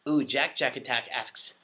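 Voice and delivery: normal talking voice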